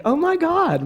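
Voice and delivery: high-pitched retold gasp of revelation; amazed realisation. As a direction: exclaim it as if it just dawned on you. Retells own sudden realisation.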